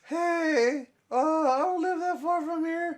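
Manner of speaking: high, loud fake woman's voice; dude doing a chick's voice. Telling a friend how the stranger called to him, in a high fake woman's voice. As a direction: mimic a guy faking a girly voice. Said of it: "a dude doing a chick's voice".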